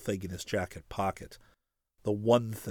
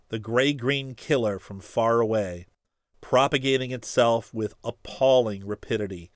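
ordinary delivery

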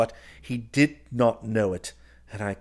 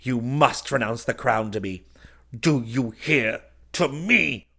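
slow down and hammer the command; triumphant cry; 3 semitones higher, 6 dB louder than usual. A narrator's reading. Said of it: "I cried, with a laugh of triumph".

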